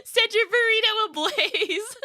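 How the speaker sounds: high, laughing dramatic line; laughing through words. Real, not acted. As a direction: giggle it out grandly. Dramatic joke line while laughing.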